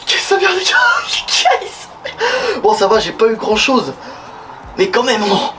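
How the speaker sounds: shivering, soaked in cold water. Real, not acted. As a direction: teeth chattering from the cold. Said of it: "il caille".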